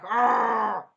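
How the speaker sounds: loud, high "argh" of mock rage; imitated anime rage yell; drawn-out acted cry of fury; super angry growl-cry. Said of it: "She's super angry".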